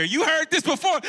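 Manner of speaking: normal talking voice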